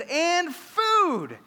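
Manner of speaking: put-on high, loud little-boy echo; little son. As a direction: mimic a toddler proudly repeating his sister. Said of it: "And he said".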